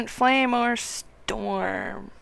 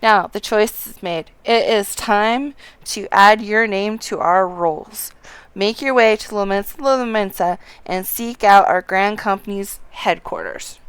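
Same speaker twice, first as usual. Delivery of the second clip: lightly voiced officer, projected; a hint of a commanding officer; Storm officer giving orders; 8 dB louder than usual. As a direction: read out orders to a new recruit, firm and loud.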